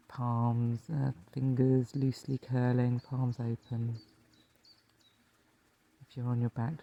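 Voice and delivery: calm meditation teacher, leaving space to breathe; guided meditation. Acted. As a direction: lead listeners gently into rest, phrase by phrase.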